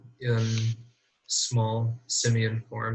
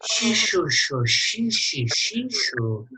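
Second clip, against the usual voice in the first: lemur whisper noises; 7 semitones higher than usual. Acted. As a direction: coo shoo and shee into an ear. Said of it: "whispers sweet nothings".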